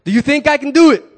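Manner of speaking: big rallying cry; loud call to the crowd. Shown as a demonstration. Speaker rousing the audience, loud call. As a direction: shout it out high to rouse the room. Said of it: "All right, here we go".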